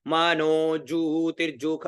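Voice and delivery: drawn out syllable by syllable; slow, stretched Vedic chant; slow recitation. Shown as a demonstration. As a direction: recite मानो जू तिरजुख as slowly as you can. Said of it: "जितना धीरे उच्चारण कर सको".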